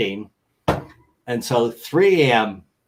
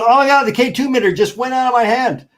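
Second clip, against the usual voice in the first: high, loud, fast faked shock; fake surprise; 11 semitones higher, 5 dB louder, faster than usual. Investigator faking shock after throwing his meter, hammed-up surprise. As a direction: act stunned by the flying meter. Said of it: "He goes".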